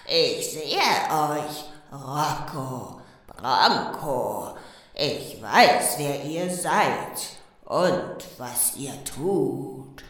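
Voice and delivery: a deep slow haunting from far away; ghost voice with echo. Acted. Schorsch scares Rocco and Bronco by radio, low slow ghost. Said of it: "diesmal mit viel Hall".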